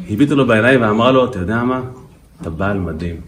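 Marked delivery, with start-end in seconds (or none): 1.2-3.2 soft, lowered voice of a loving wife; acted tenderness, hushed and sincere; tender praise. Wife looks in husband's eyes to praise him, tenderly.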